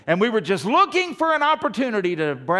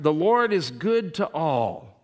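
scripture reading; 5 dB louder, slower than usual. Real, not acted.

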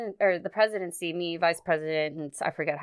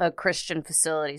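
usual speaking voice

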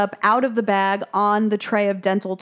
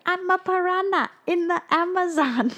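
Darla; 9 semitones higher than usual. Retold or imitated. Voicing the girl Darla.

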